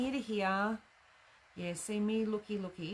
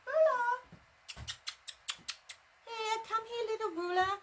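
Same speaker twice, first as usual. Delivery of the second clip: very high, sing-song coaxing call; 11 semitones higher than usual. Pretend. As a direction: call it like a lost pet. Calling the lost ruler like pet.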